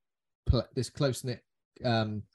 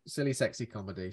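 usual speaking voice

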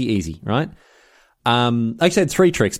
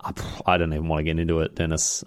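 sheepish; 7 semitones lower, 6 dB quieter than usual. Pretend. Evasive about jingle cost.

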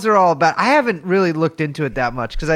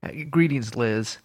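blocked nose, nasal sound; stuffy nose; 3 semitones lower than usual. Real, not acted. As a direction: talk through a stuffed-up nose. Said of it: "My nose is a little stuffed up".

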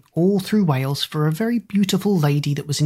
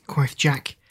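usual speaking voice

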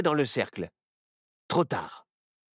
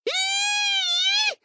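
put-on high girl's giggle; 4 dB louder, much slower than usual. Little girl squeals.

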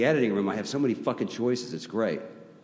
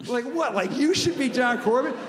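much higher, disbelieving; incredulous scoff; 12 semitones higher than usual. Retold or imitated. Guitarist friend doubting the casting, higher incredulous scoff. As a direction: scoff at the very idea. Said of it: "And he was like".

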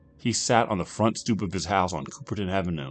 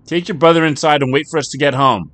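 high, loud call across the yard; 9 semitones higher, 12 dB louder than usual. Acted. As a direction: shout orders to her son as she leaves. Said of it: "Patty called out to Jonathan".

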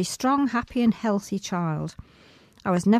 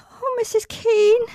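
high, plaintive call; tearful cry; 14 semitones higher, 5 dB louder than usual. Acted. Hurt child crying out to the manager. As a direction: cry out for rescue.

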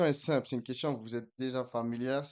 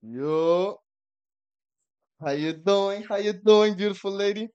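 louder, higher, drawn-out greeting; playful flirty greeting; 7 semitones higher, 7 dB louder, slower than usual. Real, not acted. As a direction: pour on the charm, loud and high. Greeting a woman on live call.

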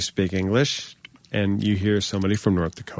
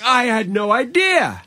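high, slow, drawn-out wonder; playful put-on surprise, pitched way up; mock amazement; 12 semitones higher, slower than usual. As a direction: act stunned at the discovery. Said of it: "And I was like".

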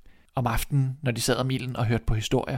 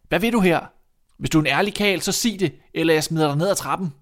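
high, loud threat; shouted challenge; 5 semitones higher, 5 dB louder than usual. Acted. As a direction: warn the intruder off loudly. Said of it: "Da råbte drengen igen". (truende råb)

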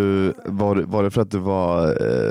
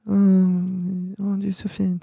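cooing partner; 9 semitones higher, much slower than usual. Retold or imitated. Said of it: "tänk dig att de är så här".

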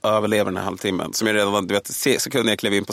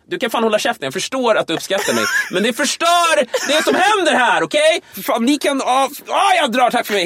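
higher, louder snapping at the heckler; angry rant; 14 semitones higher, 5 dB louder than usual. Retold or imitated. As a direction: lose it on stage and tell him off. Telling off heckler on stage.

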